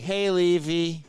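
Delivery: slow, dreamy call across the room; spaced-out patient calling. Retold or imitated. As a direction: drag it out like a drugged-up patient. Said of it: "And he's like".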